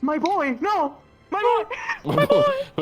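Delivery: mock anguished cry. Minnie mourning her boy, hammed-up anguish. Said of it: "she was like".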